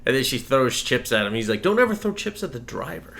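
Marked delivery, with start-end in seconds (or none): 1.6-3.1 higher, prissy scolding; a lightly voiced prim, serious driver. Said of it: "He gets real prim and serious".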